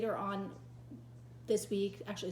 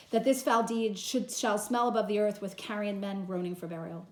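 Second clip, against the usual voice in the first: louder stage declamation; dramatic reading; 6 dB louder than usual. Acted. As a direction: finish the speech grimly. Teacher reads Antony's speech.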